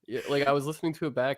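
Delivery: normal talking voice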